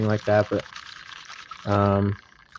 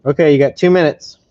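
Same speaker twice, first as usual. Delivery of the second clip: yelling across the room; 4 semitones higher, 8 dB louder than usual. Real, not acted. Dad answering child.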